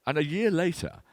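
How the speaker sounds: usual speaking voice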